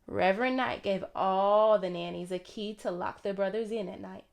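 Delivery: ordinary delivery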